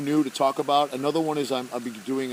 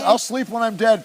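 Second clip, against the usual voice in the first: loud, high comic declaration; mock outburst; 9 semitones higher, 9 dB louder than usual. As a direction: belt it out in playful defiance. Mock outburst about lack of sleep.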